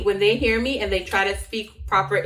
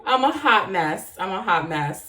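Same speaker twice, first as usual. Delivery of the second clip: ordinary talk, nothing marked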